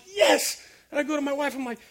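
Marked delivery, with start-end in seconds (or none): 0.1-0.6 joyful yes. Retold or imitated. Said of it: "I'd jump and I'm like".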